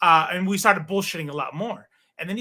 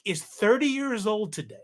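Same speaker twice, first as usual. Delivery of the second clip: ordinary talk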